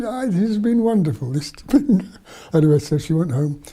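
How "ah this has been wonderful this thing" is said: high-pitched imitation of a thrilled girl; retold delighted protest. Freed girl insisting it was wonderful, retold delight. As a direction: enthuse as the girl, voice lifted high. Girl defends her captivity.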